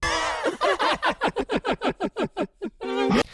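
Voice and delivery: high maniacal cackle; villain maniac laugh. Acted. Villain gloating at the viewer, high maniacal cackle. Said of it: "laughter was done in a very specific way".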